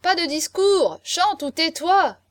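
high, loud heckling; jeering shouts. A narrator's reading. Guests boo the drunk marquis's speech, jeering. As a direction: shout the speaker down. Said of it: "une tempête de huées".

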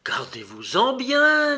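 high, loud, urgent warning; alarmed warning. A narrator's reading. Indian warning the hen's eater, alarmed. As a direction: sweep the voice up in alarm. Indian warns against eating the hen.

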